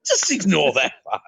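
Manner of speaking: drawn-out, falsely innocent; mock colonist. Mock colonist offering smallpox blankets.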